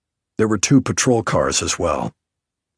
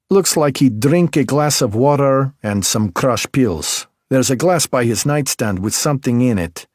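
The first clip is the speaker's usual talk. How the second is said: put-on higher, louder Belarusian-accented woman, sharp and teasing; 6 semitones higher, 4 dB louder than usual. A narrator's reading. M.E. technician Alina needles the detective in Belarusian accent.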